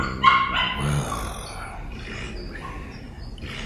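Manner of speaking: long, soft, falling sigh; weary sigh. Real, not acted. Vlogger interrupted by dogs again, weary long sigh. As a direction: sigh slowly, dropping away.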